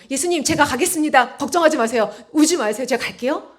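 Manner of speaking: emphatic retold promise; bold, loud declaration. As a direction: reassure boldly at full voice. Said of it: "너무나 당당히 아주 큰 소리로".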